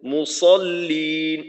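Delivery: মুসল্লিন slowly, with a thick, heavy s; মুসল্লিন drawn out, emphatic s; heavy sad. Shown as a demonstration. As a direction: make the sad in মুসল্লিন full and hold the long ending. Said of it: "সদটাকে একটু মোটা করার চেষ্টা করা".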